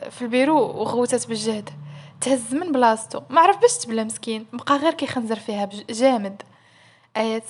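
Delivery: ordinary delivery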